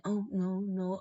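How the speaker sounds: high, soft mock innocence; mimicked false denial. Mocking her denial about drugs.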